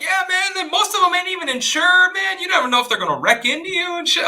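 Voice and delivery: hammed-up imitation of a griping neighbor; mock neighbor complaint. Imitating neighbor complaining about immigrants.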